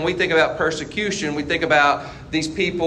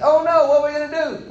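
mock panic; 11 semitones higher, faster than usual. Voicing panicked Israelites.

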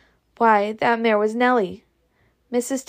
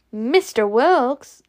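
slow, drawn-out startled question; weird delivery; 3 semitones higher, 4 dB louder, much slower than usual. A narrator's reading. Scarlet questions surprising news, oddly drawn-out delivery. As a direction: stretch the name out oddly in disbelief. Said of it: "Oh, that was said weird".

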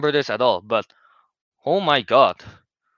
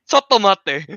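quoted Japanese, higher and louder; Japanese pronunciation; 7 semitones higher, 7 dB louder, slower than usual. Retold or imitated. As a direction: call it out in Japanese like the rat sensei. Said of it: "in Japanese".

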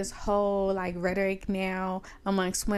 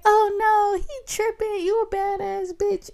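much higher, loud gushing reassurance; hype girl; 12 semitones higher, 6 dB louder than usual. Retold or imitated. Woman hyping up drunk stranger in bathroom, high and gushing. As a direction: cheer her up in a high party voice.

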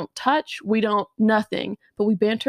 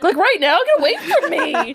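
high, loud laughing recoil; laughing disgust; 9 semitones higher, 5 dB louder than usual. Retold or imitated. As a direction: laugh while shooing him away.

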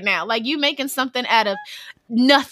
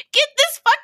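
disgusted outburst. Retold or imitated. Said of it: "I was like".

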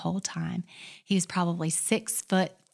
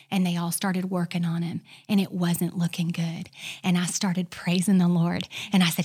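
ordinary delivery

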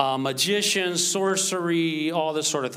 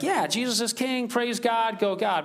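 higher, offhand church cheer; casual cheering; 6 semitones higher than usual. Acted. Pastor voicing how Westerners hear the phrase, casual cheering. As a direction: toss it off like an easy slogan. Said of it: "we have this idea".

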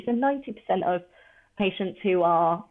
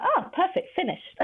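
pleasantly surprised; 12 semitones higher than usual. Retold or imitated. Patient finding the blood test already done, high and surprised. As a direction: sound delighted it's already over.

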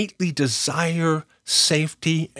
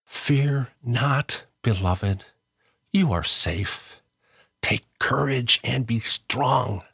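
tender blessing; 4 semitones lower, slower than usual. Shown as a demonstration. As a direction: lower your voice to reassure. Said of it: "telling them perhaps in these beautiful words".

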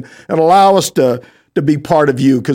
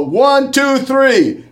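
high, loud count; loud countdown; 8 semitones higher, much slower than usual. Real, not acted. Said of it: "here we go".

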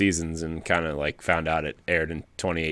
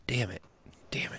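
mock little curse, softly under the breath; imitating a toddler; 4 semitones higher, 12 dB quieter than usual. Parent imitating two-year-old running round the house, soft toddler swearing. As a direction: softly mimic a small child's cursing. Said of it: "she runs around the house now and says".

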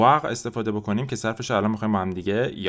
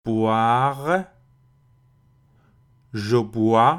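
repetition drill; much slower than usual. Shown as a demonstration. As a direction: clear conjugation read for repeating. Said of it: "répétez après moi".

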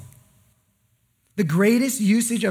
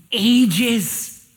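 louder; 2 semitones higher, 4 dB louder than usual. Acted. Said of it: "dramatic reveal".

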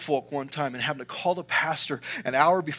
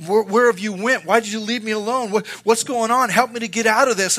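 rising, louder desperate plea, climbing higher; crying out to God; 6 semitones higher, 8 dB louder than usual. Retold or imitated. Preacher reliving crying out to God in grief, loud anguished plea. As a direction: pour out an anguished complaint, pitch and volume building. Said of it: "I was crying out".

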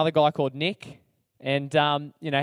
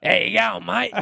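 deep-voiced bloke; 3 semitones higher, 3 dB louder than usual. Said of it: "he's just got a real deep voice".

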